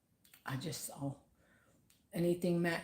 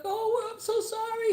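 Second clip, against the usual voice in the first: high, slow, gushing apology; mock apology; 6 dB louder than usual. The worker apologising to her, in a high, slow, tearful voice. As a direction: play the worker near tears. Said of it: "and he's like".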